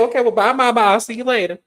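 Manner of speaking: high, sassy fake-polite goodbye; sassy dismissal. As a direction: brush her off sweetly. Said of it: "Letitia said".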